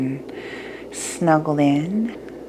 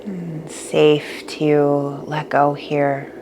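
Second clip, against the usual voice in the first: guided meditation; 6 dB quieter than usual. Acted.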